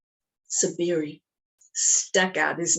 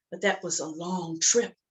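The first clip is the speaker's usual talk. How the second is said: usual speaking voice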